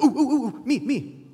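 high, loud "ooh, ooh, me"; like kids desperate to be picked; hand-waving schoolkid eagerness; eager disciples. Acted. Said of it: "The disciples are like".

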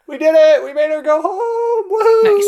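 high, loud victory whoop; excited cheer. Real, not acted. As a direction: cheer like you just won. Party cheers NPC leaving.

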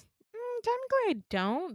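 high, reluctant correction; imitated hedging friend. Friend admits barely knowing her, high mock hedging. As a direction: mimic a friend gently backing off. Friend hedges in retold dialogue.

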